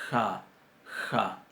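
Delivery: "ja" fricativa suave atrás; "ja" velar más grave. Shown as a demonstration. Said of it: "y tenemos la ja". ("ja" velar tranquila)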